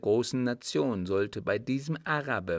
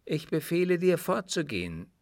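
high, raised commanding tone; imperious; 8 semitones higher, 3 dB louder than usual. A narrator's reading. The woman ordering her suitor to leave, imperious. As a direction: order him away imperiously. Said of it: "sagte sie in gebieterischem Tone".

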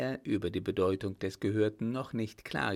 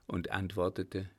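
ordinary delivery

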